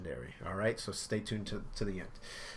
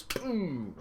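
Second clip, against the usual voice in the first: ordinary talk, nothing marked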